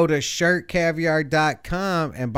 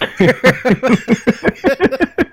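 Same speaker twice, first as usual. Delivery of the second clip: loud laugh; 10 semitones higher, 8 dB louder than usual. Real, not acted. Laughs at own ice chewing.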